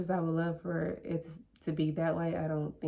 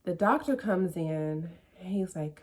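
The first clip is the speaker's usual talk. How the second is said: plain reading voice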